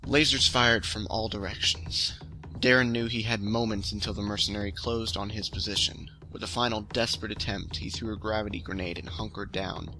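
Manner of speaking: even, steady storytelling read; story narration. A narrator's reading. Ben reads his story The Pistol to viewers, steady narration. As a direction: read the tale out evenly, letting the action carry it.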